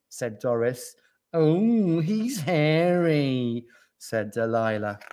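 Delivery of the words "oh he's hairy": higher, slow, drawn-out "oh"; swooning admirer. A narrator's reading. Delilah sees Gordon's display, swooning. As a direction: savour it admiringly.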